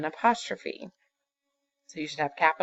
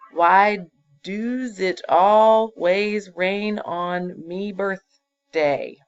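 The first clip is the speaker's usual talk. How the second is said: drawn-out, louder word-by-word read of "Why does it always rain on me birthday"; slow reading of the error; 3 semitones higher, 6 dB louder, much slower than usual. Shown as a demonstration. Teacher reads the faulty sentence aloud slowly for the class. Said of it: "Lots of mistakes there".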